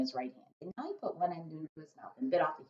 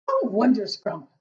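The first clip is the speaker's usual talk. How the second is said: loud, slow delighted exclaim; beaming cry; 5 semitones higher, 11 dB louder, slower than usual. A narrator's reading. Giant delighted by Sophie's praise, loud joyful cry. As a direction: let the joy burst out. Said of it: "cried the B F G, still beaming".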